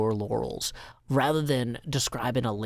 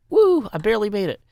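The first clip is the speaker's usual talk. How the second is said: relieved cheer; 7 semitones higher, 4 dB louder than usual. Real, not acted. Relief after close call.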